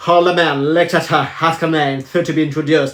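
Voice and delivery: put-on reading French class text in an English accent; lightly put-on English accent, as if back from England. Pupil back from England reads aloud in a light English accent. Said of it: "Je vous parle en quoi, là?".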